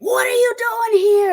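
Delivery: high, drawn-out mock holler; screaming sergeant. Sergeant confronts the new recruit off the bus, screaming. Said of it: "he starts screaming at me".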